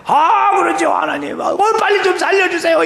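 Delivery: high frantic shout for help; desperate begging. Retold or imitated. Deacon hanging from a branch shouting to God for rescue.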